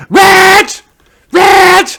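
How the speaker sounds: mild call out to Reg. Acted. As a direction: shout for Reg. Calling husband.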